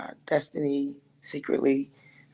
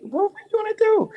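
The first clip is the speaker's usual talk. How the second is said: much higher put-on voice for Destiny; quoting Destiny; 3 dB louder than usual. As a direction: voice her high and a bit pushy. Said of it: "And then Destiny was like".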